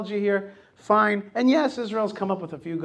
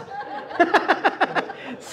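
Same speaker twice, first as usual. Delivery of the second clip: rhythmic, breath-driven laughing; real, drawn-out chuckling fit; hearty laugh; 9 semitones higher than usual. Real, not acted. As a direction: crack up at your own joke. Laughing at own flag joke.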